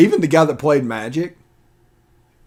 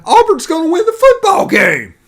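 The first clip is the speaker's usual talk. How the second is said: squeaky high-pitched mocking voice; 8 dB louder than usual. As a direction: jeer it way up high, like a cartoon. Mocking rival fan chant.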